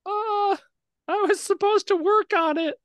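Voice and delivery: squeaky high little voice; the shrunken Minotaur pipes up; tiny squeak. Acted.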